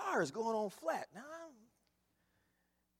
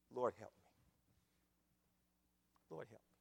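humble prayer; 6 semitones lower, 7 dB quieter than usual. Acted. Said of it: "and say".

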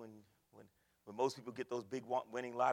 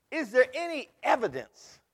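much louder, higher, calling out the question; loud rhetorical question; 9 semitones higher, 18 dB louder, slower than usual. Acted. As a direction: throw the question out to the whole church.

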